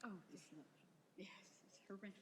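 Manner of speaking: near-whispered reaction off mic; hushed, barely audible; quiet aside. Real, not acted. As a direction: shaken murmur to a neighbour. Quiet reaction after the speech.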